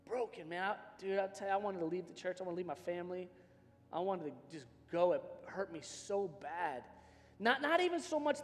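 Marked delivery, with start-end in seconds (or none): none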